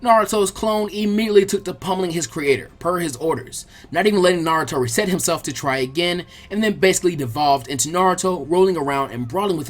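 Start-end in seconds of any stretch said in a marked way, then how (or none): none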